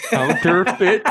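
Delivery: "Counterfeit" said again through laughter, high and loud; laughing repeat. Real, not acted. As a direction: repeat "Counterfeit" while cracking up. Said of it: "Say it again".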